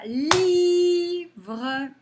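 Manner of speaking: Livre with a long, high, loud i; stretched first syllable. Shown as a demonstration. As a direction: chant Liii-vreuh in two beats. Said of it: "on entend le I dans la première".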